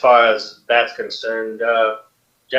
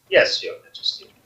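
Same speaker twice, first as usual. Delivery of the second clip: hushed reply. Acted. General answering the queen.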